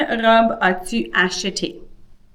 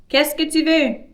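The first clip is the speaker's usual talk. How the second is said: female character voice; 8 semitones higher than usual. Acted. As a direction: play the woman at the fruit kiosk, pitched up. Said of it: "a different voice for the female character".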